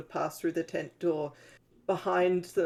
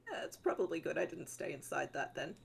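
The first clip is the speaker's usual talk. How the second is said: muttering; 2 semitones lower, 9 dB quieter than usual. Acted. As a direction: grumble it softly to yourself. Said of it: "Giel's just gonna mutter".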